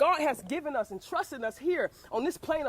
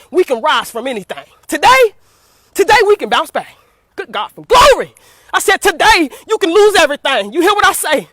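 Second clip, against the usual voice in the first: loud, high, rising church preaching; preaching at full force; 3 semitones higher, 15 dB louder than usual. Real, not acted. Urging listeners that God brings them back, preaching loudly. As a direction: preach it out to the congregation, building. Sometimes I get excited.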